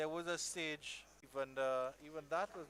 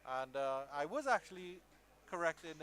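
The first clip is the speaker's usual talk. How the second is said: ordinary delivery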